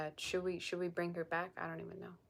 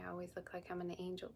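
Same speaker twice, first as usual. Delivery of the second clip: fast unvoiced whisper, much softer; quick whisper; 8 dB quieter, faster than usual. Real, not acted. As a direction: rush it out in a hushed whisper. Repeating viewer's line, whispering.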